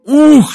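high, loud yelp of "ukh"; sudden high cry as the floor drops; startled "ukh". A narrator's reading. Belov as the submarine dives, high loud yelp. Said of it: "сказал Белов и уронил диктофон".

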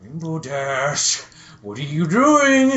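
drawn-out put-on voice, rising loud at the end; mock suspicious pony; slow suspicious question. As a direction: question her slowly, full of suspicion. Twilight questions Rainbow Dash.

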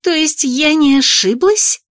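hissed. A narrator's reading. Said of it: "прошипела Бри".

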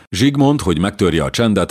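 ordinary delivery, nothing marked

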